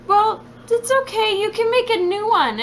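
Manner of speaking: high, lightly mocked reassurance; imitating a calm fiance; fiance comforting. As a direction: play the patient fiance. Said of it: "And he's like".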